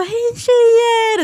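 loud, high, drawn-out hello; calling out a greeting. Retold or imitated. Dad greets the girls on FaceTime, high drawn-out call. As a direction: call out to distracted kids, stretching the words.

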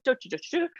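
mock posh British babble in nonsense syllables; high, light "do, do, do" aping a posh British accent. American mimicking how all England supposedly sounds, mock posh accent. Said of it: "this very posh sort of".

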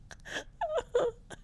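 high, tearful laugh fit; helpless laughter. Real, not acted. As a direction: laugh until it sounds like crying. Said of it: "I don't know why I'm laughing".

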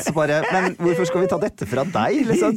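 high, slow hammed-up judge voice; mock talent-show judge praising; imitating a TV judge. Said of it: "er sånn".

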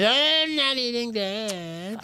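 high, drawn-out whiny refusal; mock refusal. [name] rejecting his host's pasta, high drawn-out refusal. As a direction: stretch it out like a fussy child.

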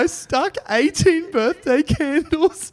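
reading through helpless laughter; words squeezed out between laughs; crying with laughter. Real, not acted. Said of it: "fucking crying".

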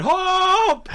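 high, loud mock cry of "Hope!" for "Help!"; imitating a screaming patient. Patient crying for help from the window, hammed-up scream. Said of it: "No, he was saying hope".

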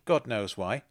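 ordinary delivery, nothing marked

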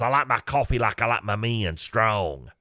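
lightly imitated smoker's voice; older woman's smoker voice. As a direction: put on a hint of a chain-smoking flirt. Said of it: "Sounded like she'd been smoking".

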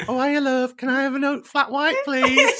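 high-pitched, hammed-up northern English accent; put-on northern twang. Host imitates herself ordering in a café, mock northern accent.

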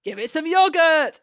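loud, high mock-rallying cry; big hammed-up holler; playful shout. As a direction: belt it out, high and silly. Shouted silly catchphrase in banter.

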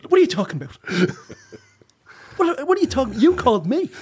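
high laughing indignant retort; mock quarrel. Mom retorts to dad, mock quarrel. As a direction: snap back, half laughing. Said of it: "the la".

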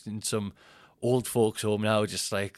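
usual speaking voice

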